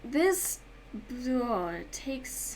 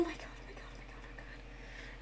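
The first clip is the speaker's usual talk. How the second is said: whispered oh my God, trailing off slowly; panicked whisper; 5 semitones higher, 18 dB quieter, slower than usual. Retold or imitated. Reliving the bee in her mouth, she whispers in panic. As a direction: whisper it in shock. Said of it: "I was literally like".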